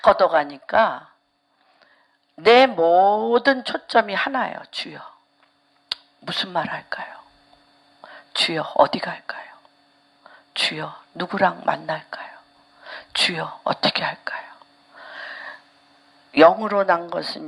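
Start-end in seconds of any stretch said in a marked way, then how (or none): 4.7-14.5 soft, lower whispered pleas to the Lord; whispered prayer. Retold or imitated. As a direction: whisper each question like a humble prayer. Quoting her own prayers. (낮게 속삭이는 기도)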